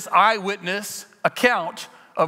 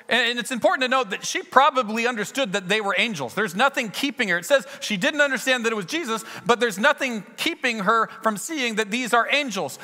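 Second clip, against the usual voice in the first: normal talking voice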